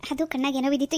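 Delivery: ordinary talk, nothing marked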